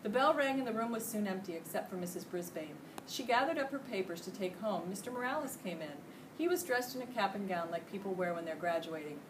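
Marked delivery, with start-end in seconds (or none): none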